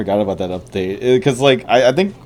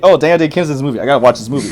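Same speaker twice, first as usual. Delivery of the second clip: eager, raised excited voice; 6 semitones higher, 6 dB louder, much faster than usual. Retold or imitated. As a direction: gush like a fan. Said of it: "like, seeing, like".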